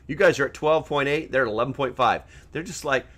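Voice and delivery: ordinary talk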